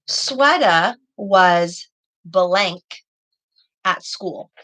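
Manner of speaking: very slow, pausing on blank; slow fill-in-the-blank. Shown as a demonstration. Teacher reading a Mad Libs sentence to pupils, very slowly. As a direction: stretch the sentence out so kids hear the gap. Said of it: "So if I were to say".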